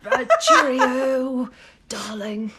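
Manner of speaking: slow, hammed-up British accent; mock-British old man, drawn out. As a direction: put on an old British gent. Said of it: "channel your most British, most inner old man".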